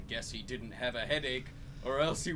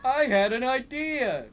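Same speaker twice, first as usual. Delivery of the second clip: higher, louder eureka cry; exclaiming; 11 semitones higher, 8 dB louder than usual. Acted. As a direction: burst out with the idea. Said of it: "he exclaimed".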